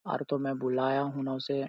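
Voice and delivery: ordinary delivery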